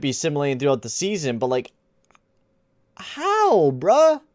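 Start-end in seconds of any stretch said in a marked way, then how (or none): 3.0-4.2 squeaky, much higher how bruh; incredulous yelp. Real, not acted. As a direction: voice cracks up in pure disbelief. Shocked at Sabres' success.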